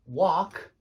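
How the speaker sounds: "wok" with an open ah, like "wahk"; American vowel. Shown as a demonstration. Teacher quotes the dictionary's American way, "wok" as wahk. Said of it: "They say".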